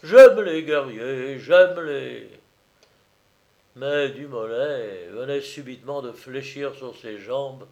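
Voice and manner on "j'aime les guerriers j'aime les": loud, slow, emphatic welcome; hearty approval. Acted. Valsuzon shaking the old soldier's hand, loud and hearty. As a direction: boom it out, drawn out.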